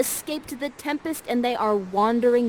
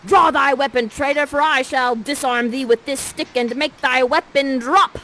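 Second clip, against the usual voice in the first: much louder, a higher hammed-up battle cry; challenge to a duel; 5 semitones higher, 8 dB louder than usual. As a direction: hurl the challenge at the traitor. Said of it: "says this".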